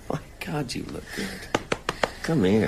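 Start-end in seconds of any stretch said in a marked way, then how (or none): 0.1-1.3 hushed, breathy whisper; soft whisper. Acted. Hospital patient whispering to his girlfriend, tender hush.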